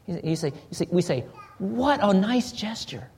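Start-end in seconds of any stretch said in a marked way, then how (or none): 1.6-3.1 WHAT drawn out slowly and pitched up; slow, higher WHAT, then easing off; emphatic WHAT. Shown as a demonstration. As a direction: stretch WHAT in slow admiration. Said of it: "there's an emphasis there".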